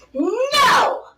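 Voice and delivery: put-on high, loud toddler's refusal; mad toddler. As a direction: shout it like a furious three-year-old, hammed-up. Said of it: "three years old and you are mad".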